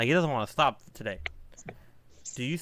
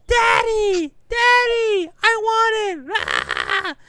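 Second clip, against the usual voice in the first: put-on shrill, much higher, loud wailing child begging for a toy; a kid screaming and sobbing for a toy; crying kid; 8 dB louder, much slower than usual. Toddler in the toy aisle crying to daddy for a toy, loud and much higher. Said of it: "Like, crying like that".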